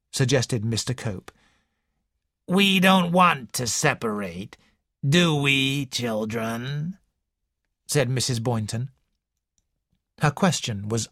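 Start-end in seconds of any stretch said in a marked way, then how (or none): 2.5-7.0 put-on slow, wheezy old woman's voice; slow wheezing invalid complaining to a visitor; wheezing old lady. A narrator's reading. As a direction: wheeze it out like a sickly matriarch. Mrs Boynton's voice.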